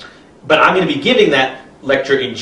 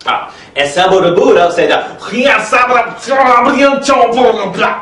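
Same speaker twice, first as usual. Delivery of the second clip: much higher, slow, shouted nonsense quote; shouting gibberish expert; 9 semitones higher, 3 dB louder, slower than usual. Acted. Gibberish expert quoting the Buddha, high slow shout. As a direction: bellow the grand proclamation. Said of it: "an example of gibberish expert translation".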